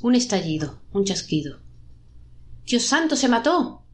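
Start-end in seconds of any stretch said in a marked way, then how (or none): 2.6-3.8 high-pitched cry of shock. A narrator's reading. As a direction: exclaim in horror, pitch raised. (grito agudo de espanto)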